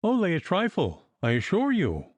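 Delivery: lisping light excuse; excusing. A narrator's reading. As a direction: play it down with a lisp. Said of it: "extenuated Puttock".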